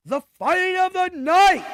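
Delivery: big, high, loud announcer call; announcer shout. Pretend. Host moving on to the top fight, announcer shout. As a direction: belt it out like a main-event billing.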